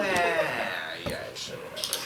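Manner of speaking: breathy, barely voiced sarcastic aside; unimpressed whisper. Real, not acted. Game master waves off the bard's grand backstory, whispering. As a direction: mutter it off, unimpressed.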